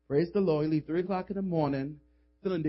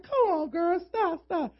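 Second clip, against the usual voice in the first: much higher playful teasing; teasing high friend voice; 10 semitones higher, slower than usual. Retold or imitated. Roommate teasing friend about the booty call, high pitched. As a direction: tease her friend.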